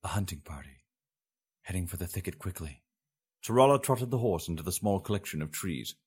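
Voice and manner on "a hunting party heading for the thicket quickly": whispering. A narrator's reading. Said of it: "he whispered".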